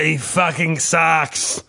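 slow, drawn-out Russian put-down; heavy Russian accent, stretched words; emphatic Russian accent. Retold or imitated. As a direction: pronounce judgement like a Russian guy. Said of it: "in his Russian accent".

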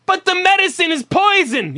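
high, dismissive fake clerk voice; mocking bored clerk. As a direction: brush off the caller like a careless clerk. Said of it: "you just tell 'em whatever".

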